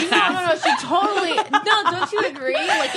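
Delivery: high, loud laughing protest. Real, not acted. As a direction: laugh through the denial.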